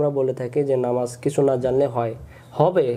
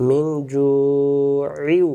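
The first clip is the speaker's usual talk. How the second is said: very slow, melodic drawn-out recitation; Quran recitation; much slower than usual. Shown as a demonstration. Teacher modelling the correct words for students, slow chant. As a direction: stretch মিন and জু'ইউ in a long chant. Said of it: "recites word as model".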